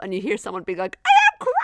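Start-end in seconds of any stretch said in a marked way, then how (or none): 1.1-1.6 very high, loud mock shriek; hammed-up hysterical shout from afar; frantic yelling. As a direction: screech it like someone losing it. Voice shouting back over radio.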